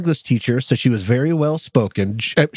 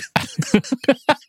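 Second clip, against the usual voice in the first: laugh; 9 semitones higher than usual. Real, not acted. Laughs at own joke.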